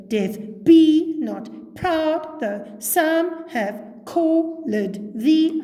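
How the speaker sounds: sing-song slow beat, "be", "proud", "some", "called", "thee" hit high and loud; exaggerated iambic stress. Shown as a demonstration. Teacher showing what would sound ridiculous, overdone stress on "be", "proud", "some", "thee". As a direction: overdo every second syllable, "be", "proud", "some", "thee", slowly. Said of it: "we don't necessarily go".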